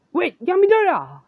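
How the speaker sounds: high, loud shout of a name; All Might calls out to stop Sen, shouting high; calling out. Acted. Hero shouts to stop the boy, high and loud.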